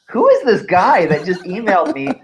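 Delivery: playfully baffled at a stranger's pitch; mock disbelief. Retelling his reaction to a stranger's email.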